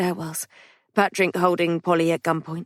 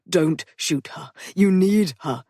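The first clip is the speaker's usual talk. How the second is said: insistent; slower than usual. Acted. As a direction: stand your ground. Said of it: "Theodore insisted".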